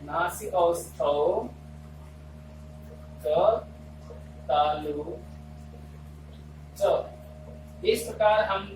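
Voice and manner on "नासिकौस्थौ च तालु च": shloka recitation. Shown as a demonstration. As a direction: recite it piece by piece, lower and deliberate, as if dictating. (धीमे, ठहर-ठहर कर श्लोक पाठ)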